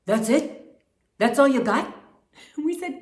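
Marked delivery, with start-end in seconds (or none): 0.1-1.9 mock-unimpressed producer, a bit loud; Richard Perry. Producer after the audition's silence, mock-incredulous imitation. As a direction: imitate him challenging them, questioning. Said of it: "he kinda had a deep voice like this".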